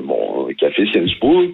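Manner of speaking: ordinary delivery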